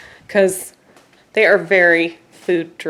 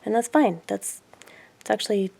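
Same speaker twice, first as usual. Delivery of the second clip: ordinary talk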